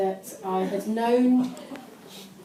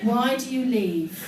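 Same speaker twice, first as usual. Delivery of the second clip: slow, mock-solemn poem recital; poem reading; 5 dB louder, slower than usual. Shown as a demonstration. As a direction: read it like a deadly serious poet. Reads own teenage poem solemnly.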